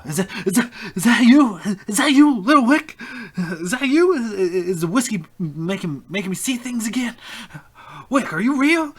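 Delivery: high, loud, desperate cry of a broken sailor; distraught old sailor. Acted. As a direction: plead in disbelief, voice cracking high. GM voices distraught drunk sailor.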